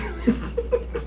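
a breathy, stifled giggle in the middle of the punchline; suppressed laugh. Real, not acted. A soft, airless laugh. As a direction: stifles a laugh mid-joke to his interviewer, breathy giggle.

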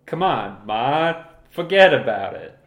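loud Boston tough guy; brash Boston bark, rising; Boston accent. Pretend. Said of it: "not Boston anymore".

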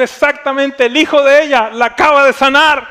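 high, forceful retold announcement; loud proclamation. Pastor tells the tour group who healed her, proclaiming loudly.

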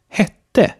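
hätte with a short, open E as in Bett. Shown as a demonstration. Teacher gives Swedish example hätte, short open E. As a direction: say hätte as Swedish hette, first vowel short. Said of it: "das kurze E".

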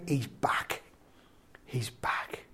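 hushed whisper of joy. Acted. Father marvelling his son is home, whispered. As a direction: breathe it out in awe.

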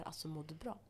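soft imitation of a worried teacher; hushed, careful question; softly concerned teacher. Retells teachers checking on her.

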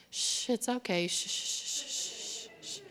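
soft quiet hushing; soothing a child. Acted. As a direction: gently shush it calm. Jesus hushing the wind.

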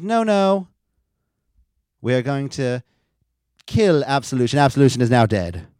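hammed-up decree of doom; Paige's firm verdict. Host voicing Paige killing the team off, loud mock decree. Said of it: "And she says".

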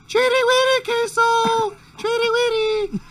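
very high loud sing-song call; high-pitched dog call at night. Retold or imitated. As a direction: squeal it like calling a pet. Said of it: "you gotta do it in a high-pitched voice".

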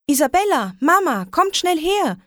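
put-on girl hearing a meow, calling urgently; excited call. A narrator's reading. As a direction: shout for them to hurry over. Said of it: "rief ich".

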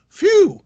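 loud high sigh of relief; relieved phew. Real, not acted. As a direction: blow out big relief. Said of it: "relief at job safe".

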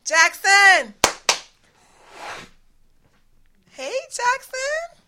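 sing-song summons toward the kitchen; calling the butler. Acted. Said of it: "So, let me call him".

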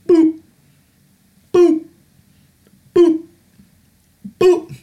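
high-pitched beep, beep noises; scanner beeps. Retold or imitated. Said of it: "sitting behind that conveyor belt going".

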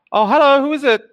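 high sweet housewife call; familiar voice, but wrong. Acted. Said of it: "a voice calls out saying".

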